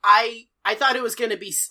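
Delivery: normal talking voice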